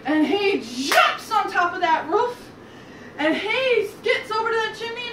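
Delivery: high, loud, building storytelling; excited climax narration. A narrator's reading. Storyteller at the wolf's chimney climb, excited and loud. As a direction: drive up high and loud, stretching the action words.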